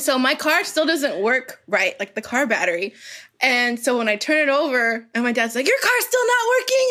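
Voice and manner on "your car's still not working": high-pitched mock complaint; exasperated dad, imitated. Dad to daughter about her dead car, mock exasperation. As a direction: imitate a dad pointing out the obvious, worked up.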